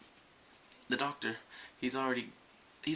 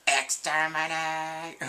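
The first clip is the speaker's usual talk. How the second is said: very slow, louder robotic Dalek cry; 4 semitones higher, 7 dB louder, much slower than usual. Retold or imitated. Fan tries the Dalek catchphrase again, slow and loud. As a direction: stretch exterminate out like a Dalek.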